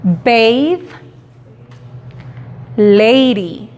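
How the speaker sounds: "bathe" y "lady" con ei marcado; long A demo. Shown as a demonstration. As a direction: marca la a larga ei. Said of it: "El sonido largo de la letra A". (demostración de a larga)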